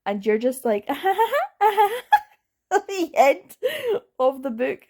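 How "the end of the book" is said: high squealing laugh into the end; high, louder disbelieving laugh; incredulous laugh. Real, not acted. Mocking the ending's sudden stop.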